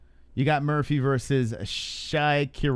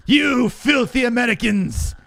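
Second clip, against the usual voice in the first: hammed-up troll, higher, slow and drawn out; mock troll shout; 9 semitones higher, 4 dB louder, slower than usual. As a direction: sneer it like the angry commenter. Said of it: "mocking the commenters".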